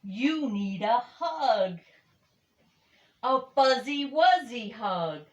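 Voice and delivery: loud, high cheerful chorus; chorus of friends. Acted. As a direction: everyone says it together, gladly. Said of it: "they all said".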